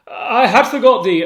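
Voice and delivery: loud startled shout. Acted. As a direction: call out sharply. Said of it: "Othello should be shouting".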